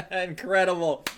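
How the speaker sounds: normal talking voice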